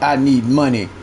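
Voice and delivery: low mock "I need money"; mocking the viewer. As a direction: parody the beggar scornfully. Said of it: "Talking about".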